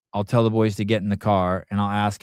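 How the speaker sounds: ordinary talk, nothing marked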